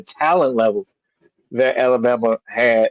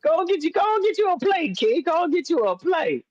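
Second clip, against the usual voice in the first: mock fussing host; 5 dB quieter than usual. Mimicking the host offering food.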